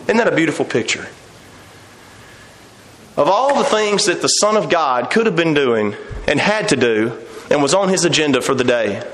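ordinary talk

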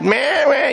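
put-on high-pitched "meh, meh" of fussing kids; imitated kids' whining. Dad mimicking kids fussing in the back, whiny. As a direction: mimic children squabbling in the back seat. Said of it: "would be fussing in the back".

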